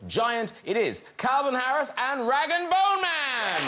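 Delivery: high-pitched, slowing showbiz build; announcer introduction. Real, not acted. Host introduces music duo to audience, announcer build-up. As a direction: announce the act with a big drawn-out finish.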